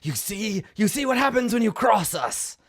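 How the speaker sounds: high, loud villainous jeer; mocking taunt. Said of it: "he says something mocking like".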